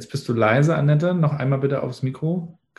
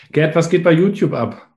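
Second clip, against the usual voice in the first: ordinary delivery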